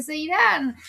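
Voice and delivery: playfully imitated nagging; childish mock pleading. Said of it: "parecen niños chicos".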